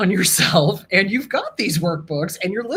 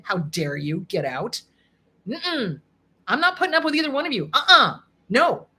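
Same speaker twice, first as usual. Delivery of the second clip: defiant dismissal; 3 semitones higher than usual. Acted. Telling off the inner abuser.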